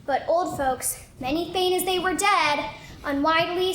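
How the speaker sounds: usual speaking voice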